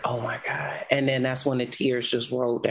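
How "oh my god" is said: low, much softer gasp of dismay; overwhelmed. Retold or imitated. Recalling her son's plea at the drive-thru, low and hushed. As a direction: let it slip out as the tears come. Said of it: "And I'm like".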